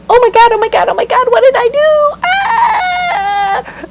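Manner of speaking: hammed-up shock, spiralling into a scream; panicked oh my God. Client walking away after quitting, hammed-up panicked shriek. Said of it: "you're gonna walk away going".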